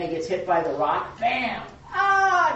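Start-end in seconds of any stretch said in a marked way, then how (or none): 1.9-2.5 high, loud hammed-up yelp; cartoon ouch, much higher and louder; mock cry of pain. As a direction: yelp like a rock just hit you. Cartoon man hit by rock.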